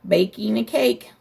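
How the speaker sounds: plain reading voice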